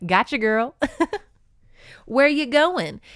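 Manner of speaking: mock-flirty gotcha; teasing captor. Captor pulls captive onto his chest, teasing.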